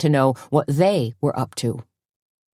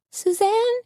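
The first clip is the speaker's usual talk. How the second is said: high, worried call of a name; a shaky, frightened calling out; quavering call. A narrator's reading. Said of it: "Tony called in a quavering voice".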